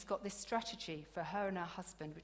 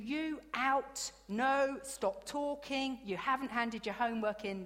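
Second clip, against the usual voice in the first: raised, higher teacher voice barking orders; usual classroom telling-off; 4 semitones higher, 5 dB louder than usual. Retold or imitated. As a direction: tell the kids off like any school day. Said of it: "my usual".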